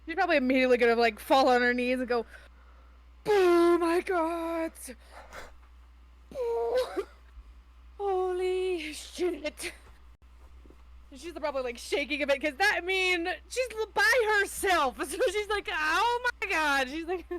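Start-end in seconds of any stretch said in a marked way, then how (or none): 3.3-9.7 stunned relief. Acted. Character falls on knees after danger. 15.7-16.9 high, loud, stretched-out exclamation; shaken disbelief. Acted. Shaking character reacts alone.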